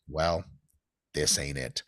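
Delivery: low, deadpan retold verdict; deadpan letdown. Lifter telling himself his knees hurt, low deadpan. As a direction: drop the pitch low, resigned.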